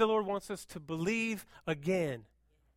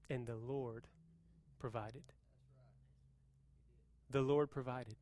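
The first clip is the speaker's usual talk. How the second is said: usual speaking voice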